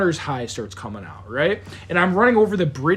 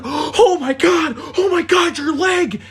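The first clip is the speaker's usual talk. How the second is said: much higher, crying panic; panicked owner; 9 semitones higher, 4 dB louder, slower than usual. Retold or imitated. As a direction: wail it like the shocked owner. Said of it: "She's like".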